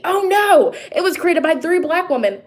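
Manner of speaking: mocking Twitter critics. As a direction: sneer it out as their outraged reply. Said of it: "people went crazy".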